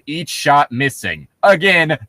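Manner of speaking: usual speaking voice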